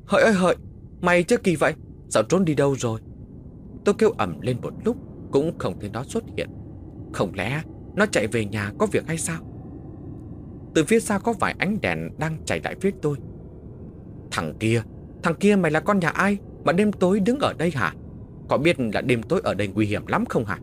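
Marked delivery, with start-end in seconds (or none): 0.1-3.0 loud searching call into the dark; calling out loudly. A narrator's reading. Narrator calls out for his vanished friend, loud. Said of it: "vừa kêu ầm lên". 14.3-20.6 scolding call. Acted. Night patrol scolds stranger.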